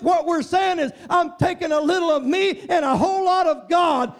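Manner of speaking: high, loud sermon build; rising impassioned preaching; fiery preaching. Acted. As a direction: preach it hard to the congregation. Preaching climax.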